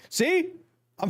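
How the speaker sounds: laughing high-pitched on see; giggly see after the eyeball stunt. Real, not acted. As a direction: squeak it out through a laugh. Laughs after eyeball water joke.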